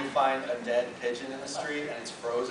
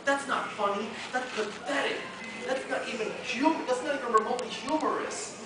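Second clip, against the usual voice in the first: high-pitched comic indignation; indignant; 10 semitones higher than usual. Acted. Comic character scolding a rival's gag, indignant.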